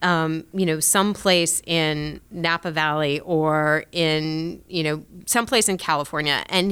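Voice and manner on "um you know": usual speaking voice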